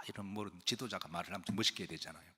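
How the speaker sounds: usual speaking voice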